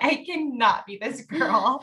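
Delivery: playful retold outburst; exasperated protest. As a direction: insist you can't be her, half laughing.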